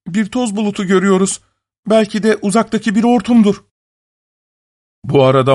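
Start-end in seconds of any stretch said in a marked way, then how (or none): 0.1-3.6 high-pitched women's character voice; sisters on lookout. A narrator's reading. Sisters answering from the walls, high urgent report. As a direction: report back in a high calling voice.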